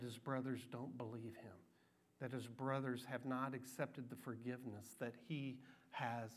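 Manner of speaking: quiet, heavy sorrow; mournful hush; sorrowful. Real, not acted. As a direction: sink low and soft with grief. Describing Joseph's broken heart.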